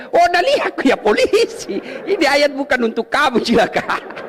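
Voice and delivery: high scolding police officer voice; mocking policeman. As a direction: play the police officer scolding a cheeky student. (suara polisi yang memarahi)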